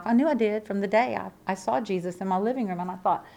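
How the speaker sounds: normal talking voice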